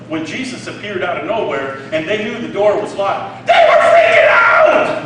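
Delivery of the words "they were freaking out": high, loud shout of alarm; big preacher's shout about freaking out; excited shout. Acted. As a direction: yell it like panic broke out. Preacher shouting disciples' panic.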